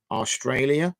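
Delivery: best-effort Australian accent on the place name; attempted Australian accent. Shown as a demonstration. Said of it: "that was my best Australian accent".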